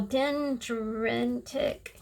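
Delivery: very slow, careful "Dendritic"; careful enunciation. Real, not acted. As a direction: sound out "Dendritic" syllable by syllable. Sounding out term before defining it.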